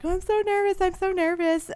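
high, jittery mock stage fright. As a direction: squeak it out like a nervous singer. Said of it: "And she's like".

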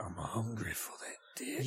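breathy sultry whisper. Retold or imitated. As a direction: whisper it slowly like a seductress. Mocking character's line in movie.

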